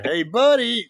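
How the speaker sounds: high, loud caricatured greeting; mock chummy catchphrase. Mimicking the man who keeps greeting him, mock chummy. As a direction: call it out in an exaggerated high voice. Voicing someone's irritating catchphrase.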